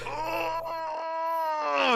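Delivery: high strained oh; a quick high strained oh; mock grunt. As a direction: voice the opponent's effort. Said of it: "he's like".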